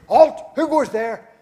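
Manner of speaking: high, loud sentry challenge; German soldier challenging a noise in the dark, shouting; German guard's shout. Acted. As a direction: bark it out like a guard on the wall. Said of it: "Again, the German soldiers".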